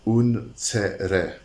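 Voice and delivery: unsere said the German way, OON-zeh-reh, rolled r; German pronunciation. Shown as a demonstration. Host showing viewers the German word, German unsere. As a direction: give unsere its German vowels. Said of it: "And it's pronounced".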